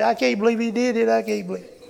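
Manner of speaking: high, incredulous gasp of a believer, hammed up; mock astonishment. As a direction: pretend you cannot believe the prayer worked. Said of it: "and you say".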